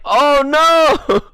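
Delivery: high, loud cry of mock dismay; amused dismay. Realizing the flight is soon, high loud laughing cry. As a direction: yelp it out in amused horror.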